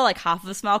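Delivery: ordinary talk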